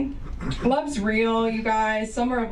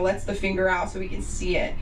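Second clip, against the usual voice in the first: ordinary talk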